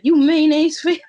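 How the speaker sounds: loud, mock-heated jab; heated insult. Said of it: "you get real heated".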